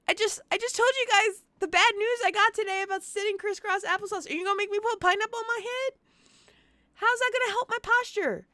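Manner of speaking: very high-pitched, hammed-up complaint; mock distressed whining. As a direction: wail at the chat in playful outrage. Mock complaint to chat about redeem.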